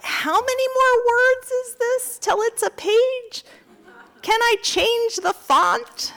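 high, slow put-on kid voice; mother imitating her daughter's high whine; daughter's whiny voice. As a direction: play the bored child asking. Daughter voiced while writing.

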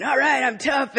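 self-mocking show of nerve; mock tough bravado. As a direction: puff yourself up as the tough one. Acting out bravado entering prison.

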